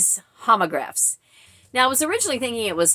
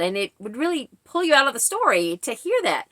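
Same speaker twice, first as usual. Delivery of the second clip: normal talking voice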